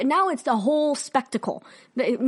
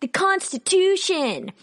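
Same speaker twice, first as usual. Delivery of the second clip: higher, slow, drawn-out sarcastic rallying cry; mock cheerleading; 4 semitones higher, slower than usual. Mocking supporters defending the park women, exaggerated mock cheer. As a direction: parody a zealous supporter, stretching the words. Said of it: "defending them like".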